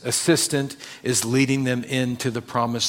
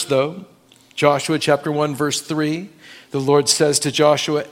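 ordinary delivery

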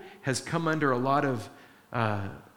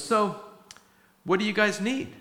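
casual Peter; 7 semitones higher than usual. Retold or imitated. Peter greeting Cornelius's servants.

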